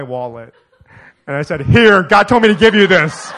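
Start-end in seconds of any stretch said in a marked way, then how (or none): 1.6-3.3 loud, laughing hand-over; laughing offer. Retold or imitated. Preacher handing his wallet to the taxi driver, loud and laughing. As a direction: thrust it out with a laugh.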